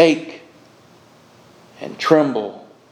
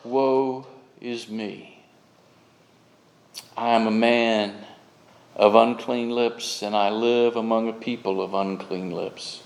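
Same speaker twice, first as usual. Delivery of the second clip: solemn cry of woe; 4 semitones lower, 5 dB quieter than usual. Acted. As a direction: confess it gravely, sinking under the guilt. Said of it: "what they will cry is".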